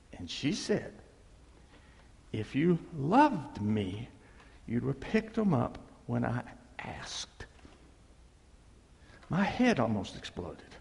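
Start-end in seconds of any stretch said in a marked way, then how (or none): 2.3-7.5 hushed hurt reply. Retold or imitated. Husband retelling wife's hurt answer about his shoes, hushed. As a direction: let it drop to a hurt near-whisper.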